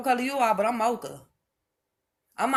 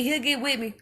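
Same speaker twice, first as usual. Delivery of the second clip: ordinary talk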